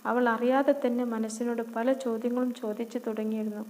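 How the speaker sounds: ordinary delivery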